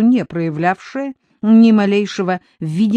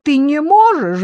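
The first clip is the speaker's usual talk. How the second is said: high, loud plea; imploring, raised-pitch question; pleading; 8 semitones higher, 6 dB louder than usual. A narrator's reading. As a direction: beg him impatiently. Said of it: "взмолилась Дуся".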